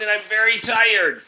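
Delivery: high, loud whiny complaint; complaining. Acted. Louis complaining at the door about the heavy box. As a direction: whine it from under the box. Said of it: "he complained".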